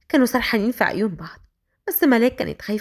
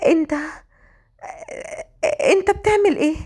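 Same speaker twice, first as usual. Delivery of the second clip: frightened; 6 semitones higher than usual. A narrator's reading. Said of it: "وخايفة منه جدًا".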